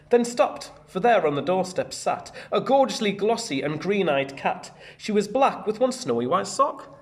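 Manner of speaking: put-on lively read-aloud to children; bouncy storybook narration; story reading. A narrator's reading. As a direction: read it out like a picture book for the class. Teacher reads picture book aloud.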